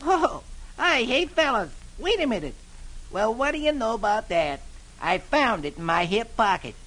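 sly, innocent delight; cheerfully. Acted. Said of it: "says cheerfully".

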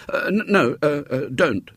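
put-on high, stammering boyish plea; desperate. A narrator's reading. Boy to sobbing girl, desperate. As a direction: stumble over the words in desperation. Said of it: "said William desperately".

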